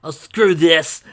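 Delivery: loud, exasperated character voice; fed-up outburst. A narrator's reading. Fighter quitting a stuck sword, fed up and loud. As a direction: throw the line away in disgust.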